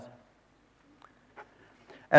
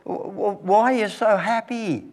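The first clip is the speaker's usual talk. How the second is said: higher, stammering parent's voice; amazed parents; 10 semitones higher than usual. Retold or imitated. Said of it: "they said to them".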